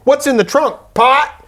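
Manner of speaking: lightly voiced nosy cop. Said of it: "the cop chimes in again".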